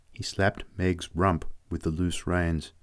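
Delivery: plain reading voice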